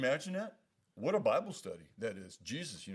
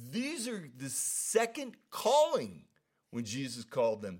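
ordinary talk, nothing marked

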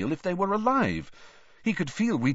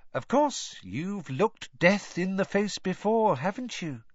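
Rita Skeeter; 10 semitones higher than usual. A narrator's reading. Rita presses Harry.

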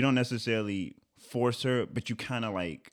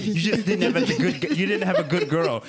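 laughing retold insistence; 6 semitones higher, 4 dB louder than usual.

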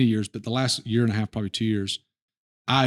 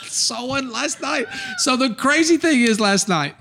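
high, eager burst, slowed down; can't wait to tell it; jumping in excitedly to share a story with the guest; 13 semitones higher, 3 dB louder than usual. Real, not acted.

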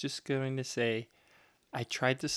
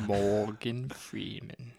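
slow, drawn-out actor impression; Morgan Freeman; much slower than usual. Co-host showing off an actor impression, slow Morgan Freeman voice. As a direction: draw the name out like a famous narrator. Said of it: "That's, that's my impression".

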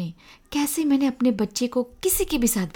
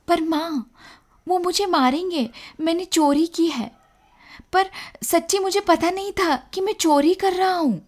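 put-on high-pitched anxious boy's plea; pleading child; 6 semitones higher than usual. A narrator's reading. Boy fears shopkeeper's beating, high anxious pleading. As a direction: protest to mother, voice raised high in worry.

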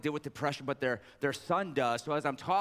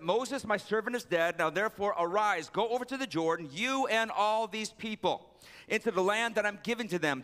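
raised, declaiming Bible reading voice, higher; scripture reading; 5 semitones higher than usual. Acted. Pastor reading God's charge to Joshua, declaimed high. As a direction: proclaim the verse like a preacher.

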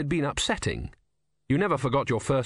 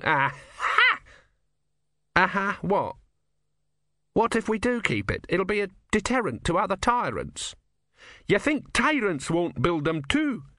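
put-on higher, needling old man voice; probing old philosopher; 8 semitones higher than usual. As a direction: press him with sly questions. Didactylos argues with Ern.

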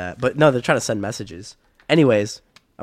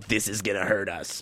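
Jaeger; 3 semitones lower, 5 dB quieter than usual. Retold or imitated. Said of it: "That's exactly how he talks".

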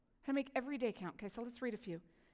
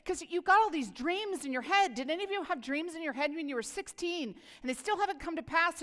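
usual speaking voice

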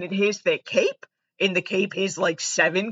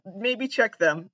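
usual speaking voice

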